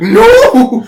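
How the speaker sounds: high, loud, long mock scream; future clone's cry. Future clone bursts in to stop the move, screaming. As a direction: yell it in comic panic. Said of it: "the future clone comes in and is like".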